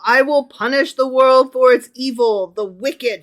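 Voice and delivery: loud, commanding proclamation; forceful, stretched prophetic verse; dramatic scripture reading. Acted. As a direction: declaim it as divine judgment. Reads scripture aloud to co-host.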